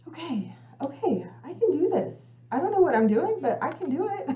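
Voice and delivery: high-pitched, hesitant pep talk to oneself; tentative self-reassurance. Retold or imitated. Retold self-talk after peace comes.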